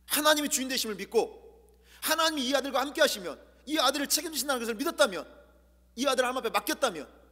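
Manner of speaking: fervent high preaching climax; impassioned preaching. Acted. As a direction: drive the point home from the pulpit. Preaching at full force.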